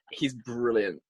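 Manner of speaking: usual speaking voice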